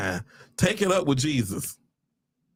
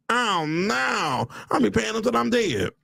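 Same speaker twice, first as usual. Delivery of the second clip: high, drawn-out wail of dread; hammed-up despair; mock dismay; 8 semitones higher, slower than usual. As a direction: lament the endless debt in a high voice. Acting out dread at the debt.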